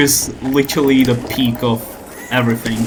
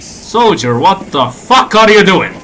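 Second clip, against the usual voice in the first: ordinary delivery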